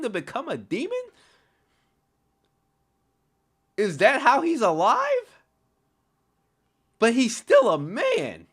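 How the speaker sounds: real stunned outburst, pitch way up; incredulous shouting. Real, not acted. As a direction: yell it out, utterly baffled by the twist. Shocked reaction to game's story twist.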